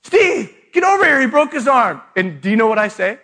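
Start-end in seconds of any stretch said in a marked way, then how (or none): 0.0-2.0 imitating the wife's high, loud call across the field; mock urgent yell across a field. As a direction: shout for him to come over, higher and louder. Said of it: "she goes".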